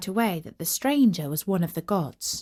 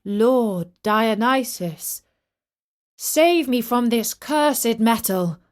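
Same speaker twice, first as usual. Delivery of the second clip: slow, louder plea; calling out; 4 dB louder, slower than usual. Acted. King calls to the god for rescue, pleading. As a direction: call for help across the distance. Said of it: "He called out".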